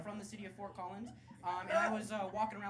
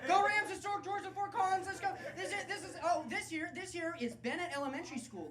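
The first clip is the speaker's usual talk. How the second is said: hawking historic tours in a raised, eager voice; tour guide; 8 semitones higher than usual. Acted. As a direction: call out to a walking tour group. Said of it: "walking around being like".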